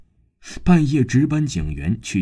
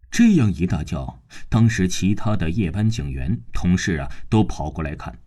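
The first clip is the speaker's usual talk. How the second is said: ordinary delivery, nothing marked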